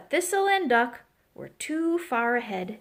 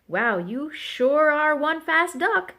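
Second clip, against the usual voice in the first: slow, higher admiring call; calling after someone; 5 semitones higher, 4 dB louder, slower than usual. A narrator's reading. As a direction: call out after a runner, stretching words. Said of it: "Duck called after her".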